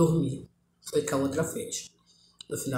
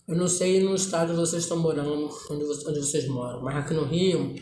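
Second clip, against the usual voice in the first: usual speaking voice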